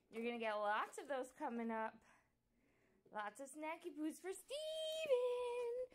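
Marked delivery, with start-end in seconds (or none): 3.1-5.9 high, slow sing-song coo over a treat. Real, not acted. Owner cooing to her dog Steve, high slow baby talk.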